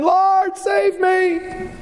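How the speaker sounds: high desperate cry for help; a sinking man's high plea. Retold or imitated. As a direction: call out Lord save me as sinking. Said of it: "he cried out".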